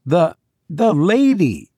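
broken, abrupt question. A narrator's reading. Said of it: "said Will abruptly".

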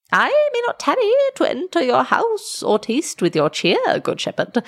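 high, swooping otherworldly voice; dwarf messenger, formal. A narrator's reading. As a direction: decline solemnly in piping tones. Dwarf declines invitation.

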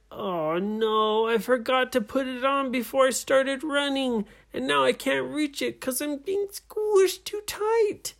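high, strained story-character voice; distressed captain. A narrator's reading. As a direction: voice the squeezed captain, pitch climbing.

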